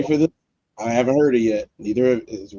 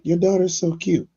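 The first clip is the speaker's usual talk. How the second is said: imitating strangers; 8 semitones higher than usual. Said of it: "people walk up and they be like".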